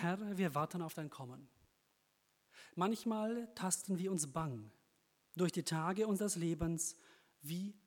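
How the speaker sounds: measured, devout recitation; prayer poem reading. Acted. Preacher recites a New Year prayer poem to congregation, devout.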